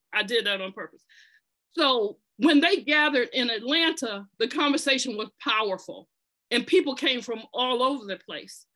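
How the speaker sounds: ordinary talk, nothing marked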